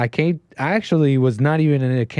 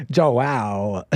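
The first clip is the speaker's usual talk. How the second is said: low, drawn-out name in mimicry; imitating the caller; 5 semitones lower than usual. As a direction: mimic his stretched-out way of saying it. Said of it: "it's funny to hear him say that".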